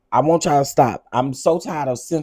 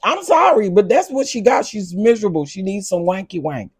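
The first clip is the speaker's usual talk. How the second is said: much higher and louder, fired-up; heated rant; 7 semitones higher, 5 dB louder than usual. Real, not acted. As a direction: blurt it out, worked up. Fired-up rant about Jackie.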